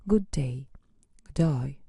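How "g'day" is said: Australian g'day. Shown as a demonstration. Teacher demonstrates the Aussie greeting, Australian accent. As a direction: say "g'day" clipped, one quick Aussie word. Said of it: "Compare these examples of British and Australian English".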